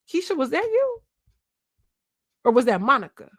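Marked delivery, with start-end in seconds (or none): 0.1-1.0 high teasing call to Keisha; coaxing Keisha, pitched way up; playful call-out. Real, not acted. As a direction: sing it up high like calling her out. Calls out to Keisha.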